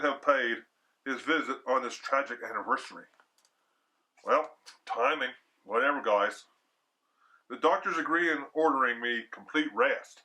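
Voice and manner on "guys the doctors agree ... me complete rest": ordinary delivery, nothing marked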